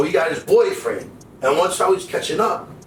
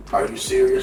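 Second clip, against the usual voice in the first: stunned disbelief; 12 semitones higher than usual. Retold or imitated. Son reacting in disbelief.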